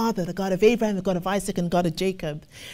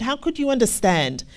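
normal talking voice

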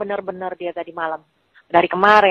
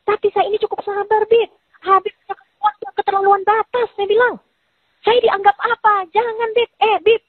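heated scolding; 12 semitones higher than usual. Retold or imitated. Scolding Habib in a retold fight.

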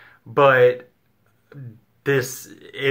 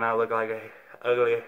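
talking around half-inserted denture; speaking while putting in denture; 5 dB quieter than usual. Real, not acted. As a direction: hand and denture at lips. Seen 4: Hand holds denture at open mouth, pushing it in while speaking.

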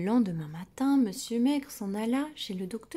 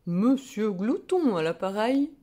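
hearty phone answer; 7 dB louder than usual. A narrator's reading.

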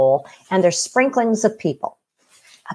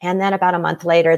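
ordinary talk

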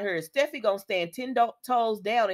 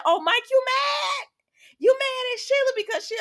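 high, loud teasing shout; mocking taunt; 4 dB louder than usual. Host taunts jailed Mike with a loud, high, mocking shout. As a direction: taunt him gleefully.

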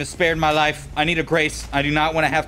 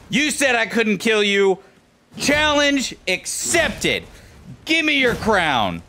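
mocking taunt; 7 semitones higher, slower than usual. Real, not acted. Taunting the boss mid-fight.

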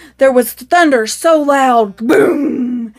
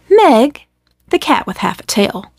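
usual speaking voice

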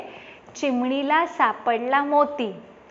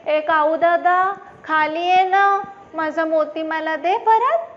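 higher, louder sing-song call upward; sparrow calling up; 3 semitones higher, 4 dB louder than usual. Acted. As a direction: call up to the tree, pleading. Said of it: "चिऊताई म्हणते".

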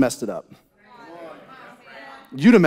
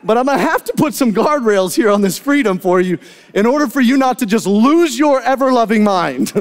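higher, half-laughing dad talk; laughing parent; 5 semitones higher, 3 dB louder than usual. Retold or imitated. Father telling his kids about guardrails, laughing. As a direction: explain with a chuckle. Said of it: "I'm like".

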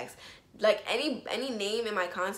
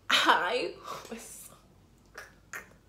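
breathless laugh; 9 semitones higher, much slower than usual. Real, not acted. Laughing at her own anger.

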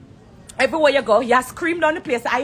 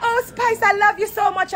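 higher, gushing mock of a fake friend; mock fake affection; 7 semitones higher than usual. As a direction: overdo the sugary greeting. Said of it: "So when me see you".

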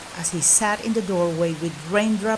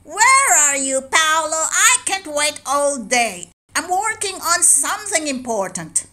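impatient shout; 7 semitones higher than usual. Acted. Master calling his apprentice from another room, impatient shout. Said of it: "Lorenzo shouted from the other room".

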